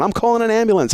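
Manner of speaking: hammed-up high panic from the assistant calling an ambulance; mock panicked assistant. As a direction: play the panicked assistant going high and frantic. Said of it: "she's freaking out going".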